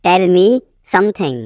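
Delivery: teacher setting "something" apart; broken-up repeat. Shown as a demonstration. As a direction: pause before "something".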